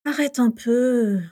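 slow, drowsy pleading in a changed voice; slow half-asleep begging; sleepy plea. A narrator's reading. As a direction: beg him to stop, half asleep. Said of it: "le supplia Rémus, à moitié endormi".

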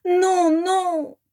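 high, crying "non, non"; high tearful wail of despair; anguished moan. Acted. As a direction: cry out in despair as they fly off. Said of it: "gémit Vera".

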